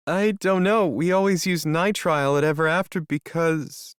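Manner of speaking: put-on nervous young woman voice; anxious young woman. Melody anxious, higher.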